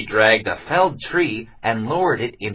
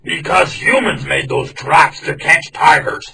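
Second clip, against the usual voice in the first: louder, higher tiger reasoning coldly; tiger's voice; 6 semitones higher, 6 dB louder than usual. Acted. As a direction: justify it flatly. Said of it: "said the tiger".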